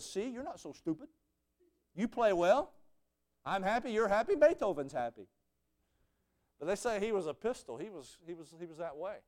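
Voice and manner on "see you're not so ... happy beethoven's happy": higher and bouncier, playful approval; grumpy maestro suddenly all smiles; mock cheerful praise. Conductor praising the soloist after a perfect run, playful cheer. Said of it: "he said".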